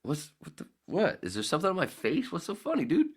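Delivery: high, rising bewildered questions; baffled high what; puzzled alarm. Retold or imitated. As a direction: ask in rising confusion, voice climbing high. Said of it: "I go".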